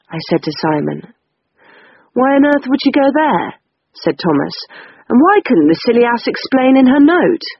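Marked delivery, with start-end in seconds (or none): 2.1-3.5 high, incredulous question; puzzled, pitched well up; baffled. A narrator's reading. Brother puzzling over where sister went, baffled. 5.1-7.4 high, indignant question; complain, pitch pushed up; exasperated. A narrator's reading. Brother cross at sister's unexplained note, exasperated.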